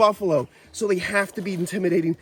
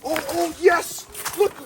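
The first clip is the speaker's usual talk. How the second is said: thrilled yes; 8 semitones higher, slower than usual. Real, not acted. Celebrating the snake find, ecstatic cheering. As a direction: cheer it out, overjoyed.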